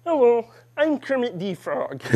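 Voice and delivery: chirpy cartoon frog greeting; Kermit the Frog. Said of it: "do a very good Kermit the Frog impression".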